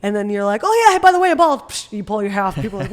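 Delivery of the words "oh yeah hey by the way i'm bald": loud, high, overly casual announcement; mock-cheery confession; breezy reveal. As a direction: drop it brightly as an aside. Acted awkward reveal on date.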